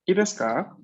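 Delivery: normal talking voice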